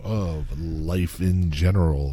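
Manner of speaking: slow, low robotic show intro. Pretend. Host opening the podcast for listeners, mock robotic. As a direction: drag out each word in a low mock-robot monotone. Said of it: "we're going to talk like this".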